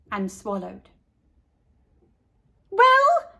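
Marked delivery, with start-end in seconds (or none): 2.7-3.3 high, loud impatient "Well?". A narrator's reading. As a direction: demand it, can't wait. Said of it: "asked Leslie impatiently".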